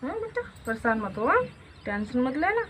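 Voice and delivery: calm her fears in a soft voice; soft reassurance. Acted. Mother tells her worried daughter all will be fine, soft and low.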